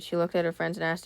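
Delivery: ordinary talk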